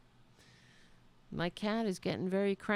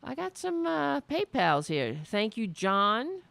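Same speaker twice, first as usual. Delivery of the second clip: ordinary talk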